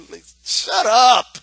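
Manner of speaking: ordinary talk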